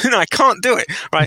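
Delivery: high-pitched mock protest; acted flustered balking; panicky refusal. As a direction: refuse in comic desperation. Acted refusal to fire someone.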